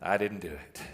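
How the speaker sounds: very low, soft guilty mumble; a quiet, lightly voiced guilty child; sheepish denial. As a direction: mutter it like a kid caught out. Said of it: "I just bailed".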